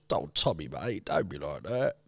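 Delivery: low, soft, back-of-the-throat whisper; Alfie voice. Retold or imitated. Showing a friend Alfie's back-of-the-throat voice. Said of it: "go back of the throat, little bit Alfie".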